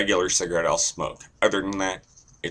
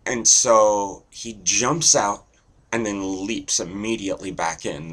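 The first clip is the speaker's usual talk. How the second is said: ordinary delivery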